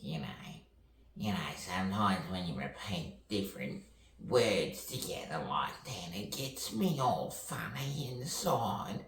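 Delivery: playful character voice climbing high; hammed-up funny voice, rising into a squeal; silly put-on voice. Silly put-on voice, playing with sounds.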